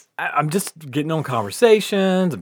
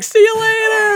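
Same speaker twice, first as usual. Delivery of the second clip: put-on kids calling; 7 dB louder than usual.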